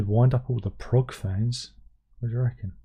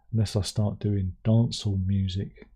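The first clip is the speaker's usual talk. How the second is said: usual speaking voice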